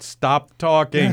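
loud, drawn-out call to stop; retold yell. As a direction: yell it out slowly, cutting people off. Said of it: "do I just yell out".

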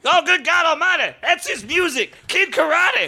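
Jim Ross. Pretend. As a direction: call it like a frantic ringside announcer. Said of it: "went into the wrestling voice".

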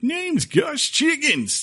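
put-on high, slow old prospector voice. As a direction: croak it like a grizzled prospector. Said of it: "he plays the old prospector".

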